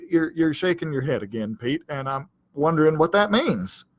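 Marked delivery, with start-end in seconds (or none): none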